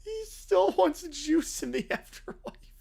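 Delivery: slow, lightly voiced reread; savoured ghost line. Shown as a demonstration. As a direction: relish the line slowly.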